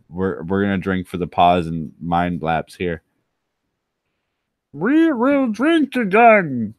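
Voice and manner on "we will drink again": high, slow put-on toast; mock solemn toast. Streamer announcing more drinking, high and slow. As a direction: declare it slowly in a high voice.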